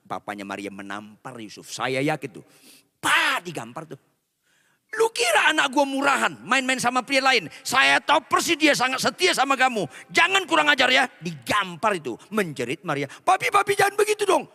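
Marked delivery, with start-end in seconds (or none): 4.9-11.1 higher, indignant scolding; angry father. Acted. Father scolding Yusuf for denying it, indignant. 13.1-14.5 high, desperate plea; pleading daughter. Acted. Maria begging her father after the slap, high and desperate.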